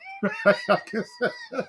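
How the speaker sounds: sudden high laugh at his own joke; a real laugh that jumps way up; high burst of laughter. Real, not acted.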